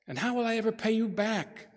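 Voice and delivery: high, groaning plea; the sick widow asking how she could repay, groaning. Acted.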